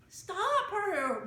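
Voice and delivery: high, frightened wail. A narrator's reading. Martha wailing at her mother's stunt, high. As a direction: cry out in alarm. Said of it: "wailed Martha".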